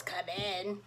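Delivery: wolf faking granny from behind the door; funny granny voice. Acted. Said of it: "She sounds funny".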